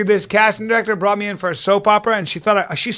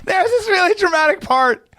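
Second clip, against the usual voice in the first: high-pitched, barely holding back laughter; breaking up; 11 semitones higher than usual. Real, not acted. Comic telling friend about an audition, cracking up. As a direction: squeeze it out high through the giggles. Cracking up while starting the story.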